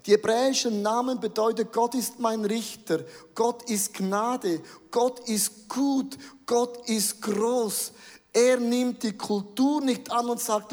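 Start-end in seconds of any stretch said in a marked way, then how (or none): 1.7-7.9 very slow, weighty declarations; preacher slows to proclaim what the Hebrew names mean; slow solemn list. Acted. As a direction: let each name of God land slowly.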